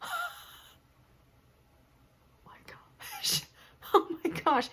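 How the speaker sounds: breathless gasped oh my gosh; horrified hush, then a laugh; whispered shock, high. Retold or imitated. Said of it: "And I was like".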